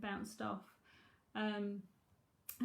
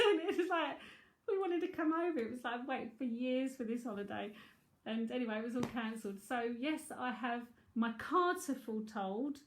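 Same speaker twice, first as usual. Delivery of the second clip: ordinary talk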